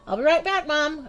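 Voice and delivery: high loud hurried shout; yelling. A narrator's reading. Girl shouts to mom at supper table, high loud. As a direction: shout it over your shoulder. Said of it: "I yelled".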